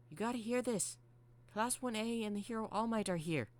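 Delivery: high, urgent character voice; high-voiced excitable robot; excited Mangle voice. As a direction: pitch it way up and blurt the news.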